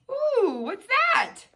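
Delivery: high, wondering "Ooh, what's that?"; mock surprise. Teacher to child about his picture, high pretend wonder. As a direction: gasp with big pretend wonder.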